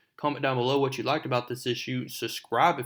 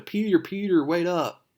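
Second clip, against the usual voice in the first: high, louder call down the hallway; calling after him; 6 semitones higher, 5 dB louder than usual. Retold or imitated. Mary Jane chasing Peter down the hall, calling out. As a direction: call out after someone running off.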